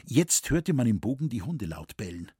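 usual speaking voice

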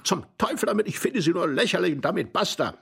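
high-pitched, indignant dismissal; exasperated outburst. Acted. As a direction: throw it out in exasperation. Quarrel, dismissing the other.

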